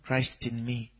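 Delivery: soft, low recitation; resumed meditation. Shown as a demonstration. Preacher acts a meditator continuing after a stray thought, quiet recitation. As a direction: drop back under your voice. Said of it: "while you are continuing".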